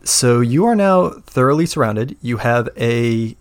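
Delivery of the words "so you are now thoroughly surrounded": hushed, menacing scene narration; ominous whisper. Acted. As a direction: whisper it like a threat. GM sets the scene.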